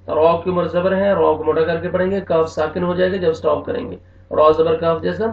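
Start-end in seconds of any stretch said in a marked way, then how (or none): none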